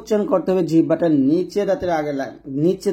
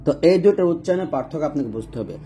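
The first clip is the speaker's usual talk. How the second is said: ordinary talk, nothing marked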